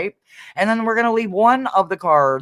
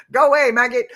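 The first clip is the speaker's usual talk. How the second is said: mock shooing; 9 semitones higher, 5 dB louder, faster than usual. Playfully shooing someone off.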